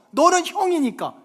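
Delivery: high, loud parental rebuke; scolding parent. Acted. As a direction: overrule the child forcefully. Parent overrules older son. (큰소리로 윽박지르는 부모 말투)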